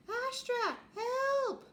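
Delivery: very high, loud scream for help; desperate cry for help. Acted. Pluto in the fight calling to Astra, high loud scream. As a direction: scream for rescue mid-battle.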